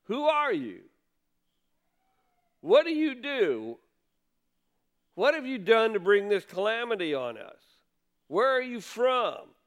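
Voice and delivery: sailors' bombarding questions. Retold or imitated. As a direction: fire questions at the stranger. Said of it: "they bombard him with questions".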